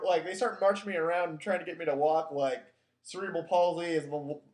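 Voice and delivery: normal talking voice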